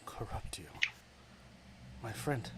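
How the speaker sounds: whispered mocking goddess. Goddess of darkness mocks the word corrupt, whispered. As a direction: hiss it back as a dark goddess. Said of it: "I gotta do this voice again".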